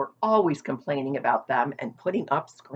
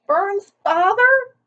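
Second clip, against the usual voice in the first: much higher, louder tearful cry; sobbing disbelief; 8 dB louder, slower than usual. A narrator's reading. Wilbur sobbing to the sheep, much higher and louder. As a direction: wail the name in shock. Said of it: "sobbed Wilbur".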